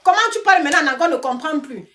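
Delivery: mock the viewers complaining about her English; mock whiny viewer. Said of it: "C'est vous qui allez venir pleurer que".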